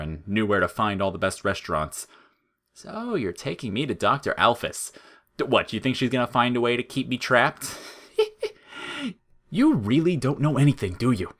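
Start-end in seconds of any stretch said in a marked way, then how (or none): 2.7-11.3 higher, taunting flower voice; mocking flower voice. Flowey mocking Frisk about Alphys, higher and taunting. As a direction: sneer it down at him.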